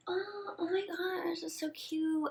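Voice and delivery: high, slow gushing over the flowers; drunk gushing. Retold or imitated. Tipsy girl gushing to her date over flowers, high and slow.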